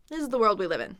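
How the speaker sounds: quick, run-together sentence; casual mumbler speech. Shown as a demonstration. Teacher shows how Americans really talk, run together. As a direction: blend the words like a casual American. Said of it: "A lot of Americans are mumblers".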